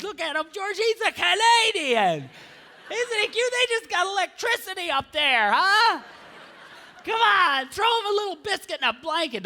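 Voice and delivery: high, cooing tourist voice; condescending lady. Retold or imitated. American woman telling George to look at the Canadian, high and gushing. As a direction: gush like a patronising tourist. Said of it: "I expect to hear more like".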